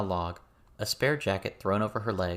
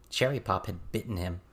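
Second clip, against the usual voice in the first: ordinary delivery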